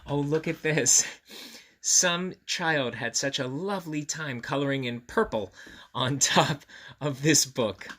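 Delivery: ordinary delivery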